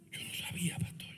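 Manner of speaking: whispered aside, no voice; hushed excuse to the pastor; mock whisper. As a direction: breathe it like a sheepish confession.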